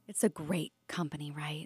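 whispering. Real, not acted. Aside about the company.

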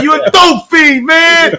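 高くゆっくり怒鳴る; 一語ずつ引き伸ばして罵る; imitating T, slow shout. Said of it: "That's how he would talk to you".

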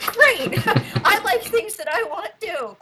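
high giggly delight; laughing. Acted. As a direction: laugh through the words. Character echoes with a laugh.

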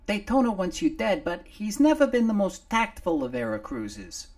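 a little face on a shoulder gulping fresh air; little Nomar. Acted. Nomar freed from under the clothing, high and loud.

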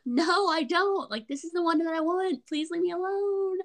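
hammed-up, long-suffering retold whine; exasperated plea. Retold plea to be left alone.

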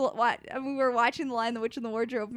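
ordinary talk

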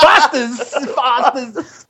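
imitating an Australian singer on TV; mock Australian. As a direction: put on an Aussie lilt while laughing. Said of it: "she was like".

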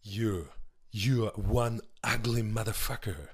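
Predator quote. Retold or imitated. [name] quoting Predator to the comic, slow and low. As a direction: growl it out like the action hero in Predator. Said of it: "That's from Predator".

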